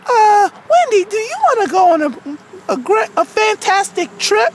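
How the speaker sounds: character storytelling voice; aggrieved Wendy. Acted. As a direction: recount it as a wronged cartoon girl. Wendy tells of the trip offer.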